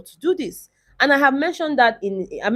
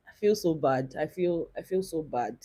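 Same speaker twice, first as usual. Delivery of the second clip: much softer, choked-up murmur fading away; close to tears; 5 semitones lower, 13 dB quieter than usual. Real, not acted. Vlogger upset over a housemate's disqualification, near tears. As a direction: let the real sadness pull the voice down. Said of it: "tell me why I'm about to cry".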